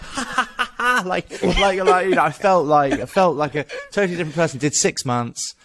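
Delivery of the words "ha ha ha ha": high-pitched, self-satisfied "ha, ha" imitation; sanctimonious mock laugh. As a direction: crow a smug pretend laugh, way up high. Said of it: "sanctimonious. I was literally just like".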